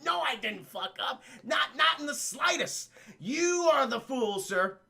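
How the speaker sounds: theatrical boastful retort; gloating villain. Retold or imitated. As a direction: crow at your opponent, hammed up. Acted villain denies his mistake.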